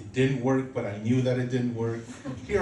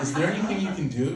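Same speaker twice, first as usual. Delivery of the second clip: higher-pitched, pitying questions; hammed-up sympathetic worry; mock grave concern; 6 semitones higher, faster than usual. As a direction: play at consoling someone with terrible news. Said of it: "like I just told them I have cancer".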